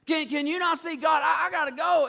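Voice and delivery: high, loud, stammered mock plea; urgent pleading. Balaam begging God to let him go, urgent pleading. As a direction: beg with hammed-up urgency.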